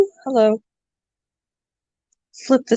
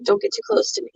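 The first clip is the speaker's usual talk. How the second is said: high little grumpy pet voice; high grumpy critter voice; voicing the hamster; 11 semitones higher, 4 dB quieter, much faster than usual. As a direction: speak for the cranky hamster, up high. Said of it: "he's like".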